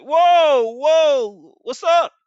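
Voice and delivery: high, loud eager whoa; excited greeting. Acted. Abraham running from the tent to greet the angels, high loud excitement. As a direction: rush up thrilled to meet them.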